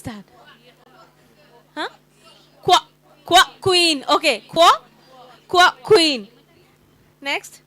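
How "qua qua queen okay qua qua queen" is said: qua qua then queen; sounding out qu. Shown as a demonstration. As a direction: chop the kw off before queen. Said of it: "What is the sound?".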